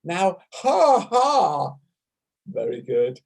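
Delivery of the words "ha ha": big open-mouthed ha, ha; open ha laugh sounds. Shown as a demonstration. Teacher leading a Zoom class, open-mouthed ha. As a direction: belt out a ha from the heart. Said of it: "So let's go with ha".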